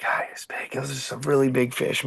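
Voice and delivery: breathless whispered awe; awed whisper. Real, not acted. Fisherman remembering the giant fish he had to release, whispered awe. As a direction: hush it like you still can't believe the size.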